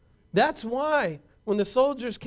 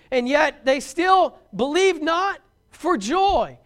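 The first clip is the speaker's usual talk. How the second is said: louder, slow, weighty delivery; preacher pressing the point home; emphatic preaching; 4 semitones higher, 6 dB louder, slower than usual. Acted. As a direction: lean hard into every word. Preaching at full force.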